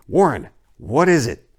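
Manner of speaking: panicked plea down the line; terrified. A narrator's reading. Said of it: "Terrified, I continued to repeat".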